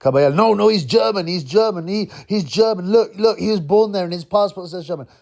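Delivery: high, insistent mocking voice; mock media voice.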